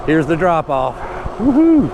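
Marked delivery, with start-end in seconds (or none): 1.4-1.9 loud high whoop that falls away; thrilled whoop. Real, not acted. Speeding down the drop-off on a scooter, thrilled whoop. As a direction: let it rip as you plunge downhill.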